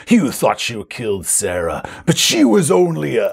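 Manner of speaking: villain's proclamation. Acted. Evil Baron begins his reveal to players, grandiose proclamation. Villain monologue cut short.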